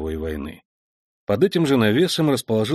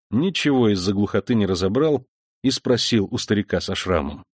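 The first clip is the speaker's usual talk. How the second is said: plain reading voice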